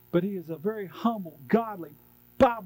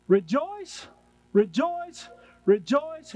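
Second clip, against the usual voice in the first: high, loud, repeated "Rejoice"; joyful exhortation; 6 semitones higher, 4 dB louder, slower than usual. Real, not acted. Closing exhortation.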